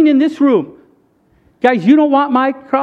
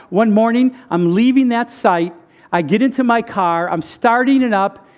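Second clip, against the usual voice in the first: normal talking voice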